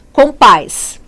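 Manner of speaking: Compás, loud, weight on final PÁS; stress on last syllable. Shown as a demonstration. Teacher quizzing on the tonic syllable, Compás stressed on PÁS. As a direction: drive home the PÁS in Compás. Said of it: "Sílaba tônica".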